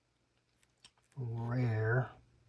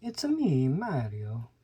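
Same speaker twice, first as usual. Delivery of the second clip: playful video-game plumber impression; Mario; 4 dB louder than usual. Quotes Mario's catchphrase as himself.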